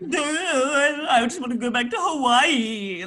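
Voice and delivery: high, loud dopey whine of a spoiled politician; dumb politician voice. Retold or imitated. Mocking the Prime Minister in a high, loud dopey voice. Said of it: "it was like".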